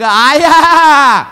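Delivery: long, loud, high drawn-out cry; hammed-up wail of delight, stretched right out; gushing mock greeting. Daughter hugging her mother at her parents' home, gushing mock cry.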